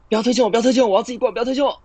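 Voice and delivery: high, loud, desperate begging; panicked pleading. Acted. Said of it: "我觉得刚刚情境剧演得好烂哦".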